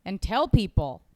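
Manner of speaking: ordinary delivery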